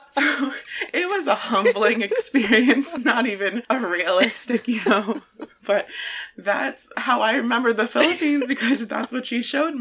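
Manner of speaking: talking through real laughter; laughing. Real, not acted. Telling a friend about mom's humbling lesson, laughing.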